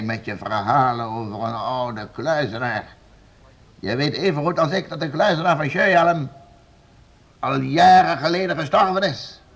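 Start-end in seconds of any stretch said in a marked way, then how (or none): 3.8-9.4 higher, louder, rising anger; angry exposure. Acted. Lord confronting servant with the hermit's death, rising anger. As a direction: hammer home the lie.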